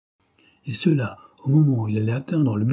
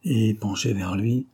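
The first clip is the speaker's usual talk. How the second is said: ordinary delivery, nothing marked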